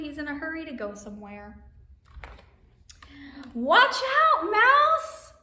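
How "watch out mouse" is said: high, loud warning shout; calling out a warning. Acted. Storyteller warning Mouse before the acorns, high loud call. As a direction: warn the mouse sharply, up high.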